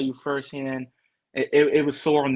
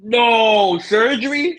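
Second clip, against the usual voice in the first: high, loud, stretched reaction ending on a rise; shocked disbelief; 7 semitones higher, 8 dB louder, much slower than usual. Real, not acted. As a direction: react in real disbelief at bad news. Hears friend needs surgery.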